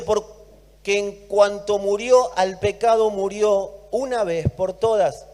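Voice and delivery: slow, weighty scripture reading; solemn reading. Real, not acted. Preacher reading Romans to the church, solemn. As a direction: read it as a proclamation.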